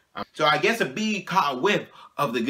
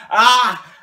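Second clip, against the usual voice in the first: high loud frightened ah; mock panicked yelp; 8 semitones higher, 7 dB louder than usual. Kid cornered by a bee in his face, panicked yelp. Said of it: "and I was like".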